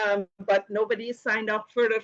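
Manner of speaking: usual speaking voice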